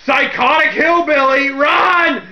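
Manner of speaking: loud, high mock-panicked yell; hammed-up panic, shouted high; freaked-out shout. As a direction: imitate terrified kids shouting a warning. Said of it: "kids are freaked out of their damn mind".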